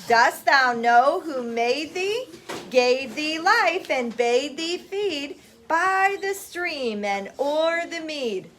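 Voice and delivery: slow, measured recital for a class; poem recitation. Acted. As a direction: lead the class through the verse, drawn out. Teacher leads class poem recital.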